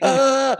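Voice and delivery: loud, high-pitched mock shriek; high exasperated scream. Daughter storming out of the party, mock shriek. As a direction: shriek like a girl mid-tantrum.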